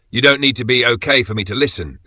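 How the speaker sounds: ordinary talk, nothing marked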